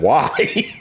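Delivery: squeaky, very high laughing why; mock granddad, cracking up; laughing grandpa. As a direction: let the laugh crack the word. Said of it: "he said".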